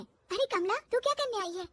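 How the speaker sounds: a hint of a young daughter-in-law; lightly voiced bahu. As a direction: greet the maid in a lifted voice.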